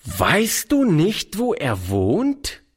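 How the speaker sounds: put-on stern old aunt insisting; pressing. A narrator's reading. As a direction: demand an answer slowly. Charlotte pressing Oliver.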